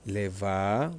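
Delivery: "Leva" with a long held VAA; stress on VA. Shown as a demonstration. Teacher pointing students to the line, held stress on VA. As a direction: draw out the second syllable of "Leva". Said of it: "look at the line".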